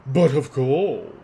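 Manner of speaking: slow, loud sarcastic bow of words; guard mocking the merchant; mock courtesy. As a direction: drip with fake politeness. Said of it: "makes an exaggerated flourish".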